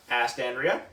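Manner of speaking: ordinary delivery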